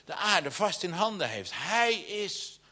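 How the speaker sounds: high, ringing preacher's read, swelling into the call to sing; preaching scripture. Acted. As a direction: proclaim it from the pulpit, lifting to a slow joyful shout. Reads scripture with rising fervour.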